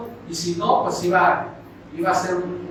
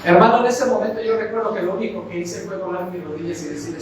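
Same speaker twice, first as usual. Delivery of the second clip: ordinary talk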